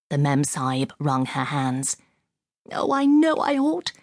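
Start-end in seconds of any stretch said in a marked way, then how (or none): 2.7-3.9 high-pitched woman's lament; distressed cry. A narrator's reading. Woman admitting her mistake to the young officer, distressed cry. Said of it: "she cried".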